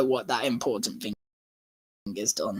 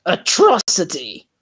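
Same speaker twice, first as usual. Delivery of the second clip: louder, outraged cry; shout; 3 semitones higher, 8 dB louder than usual. A narrator's reading. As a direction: fling the word out in outrage. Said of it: "Tom shouted".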